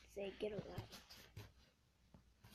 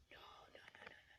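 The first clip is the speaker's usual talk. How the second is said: hushed panicked no; hushed dread as snake nears; panicked whisper; 17 dB quieter, much faster than usual. Real, not acted.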